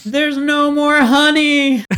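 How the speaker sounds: high, loud, laughing yell; bear yell. Shown as a demonstration. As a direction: shout it to scare a bear off. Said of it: "What would be your bear yell?".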